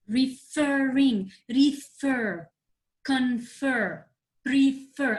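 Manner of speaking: referring, refer, confer, prefer, each with FER drawn out; stress on FER syllable. Shown as a demonstration. Teacher listing examples for the class, FER syllable stressed. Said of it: "I'm stressing the last syllable".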